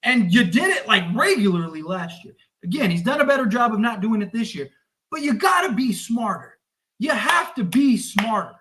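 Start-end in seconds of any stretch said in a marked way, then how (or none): none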